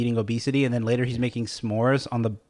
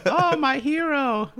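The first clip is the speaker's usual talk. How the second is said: much higher, very slow goofy voice; silly put-on voice; 13 semitones higher, much slower than usual. [name] playing along in a goofy high voice. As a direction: stretch it out like a swooning cartoon. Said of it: "answering the silly voice".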